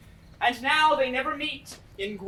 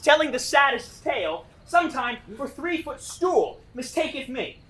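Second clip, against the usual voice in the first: ordinary talk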